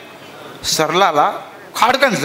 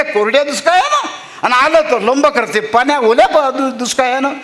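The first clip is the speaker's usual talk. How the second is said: fed-up farmer; 8 semitones higher than usual. Acted. Farmer rants at the rain to his wife in despair. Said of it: "संभानं आपला वैताग व्यक्त केला".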